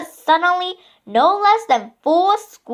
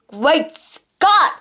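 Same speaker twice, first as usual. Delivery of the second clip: shouted. A narrator's reading. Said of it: "shouted the chief".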